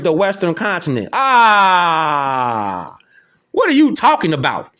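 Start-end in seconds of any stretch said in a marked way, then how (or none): 1.1-3.0 long drawn-out "ahh". Real, not acted. Triumphant reaction to dictionary quote. 3.5-4.7 high-pitched, indignant comeback; incredulous challenge. Real, not acted. Rebutting the man in the clip.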